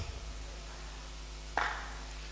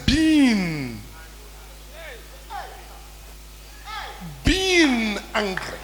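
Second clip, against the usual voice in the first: "Being, being" repeated slowly and softly before "angry"; slow stress on being; 9 dB quieter, much slower than usual. Shown as a demonstration. Preacher stressing the verse's wording for listeners, slow "being". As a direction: linger on "being", slow and deliberate. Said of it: "That is why the Bible says".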